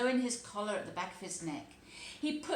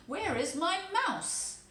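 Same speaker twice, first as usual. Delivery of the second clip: higher, louder, slow cat voice; cat asking back; 10 semitones higher, 6 dB louder, slower than usual. Acted. Simpkin the cat asking the tailor for his mouse, high and slow. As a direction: answer like a sulky cat.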